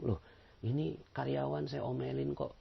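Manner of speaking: a hint of a pleasantly surprised boss. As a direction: marvel like a softened boss. Boss surprised by the patient employee.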